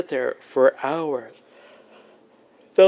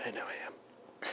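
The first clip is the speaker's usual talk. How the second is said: whispered admission; whispering; 22 dB quieter than usual. Real, not acted. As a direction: whisper it back sheepishly. Whispered reply.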